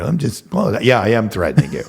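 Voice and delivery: laughing. Real, not acted. Admitting the threat, laughing.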